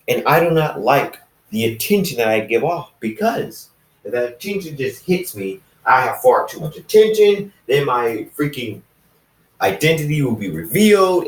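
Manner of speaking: a shadowy loner talking up his own secrecy; assassin friend speaking. Acted.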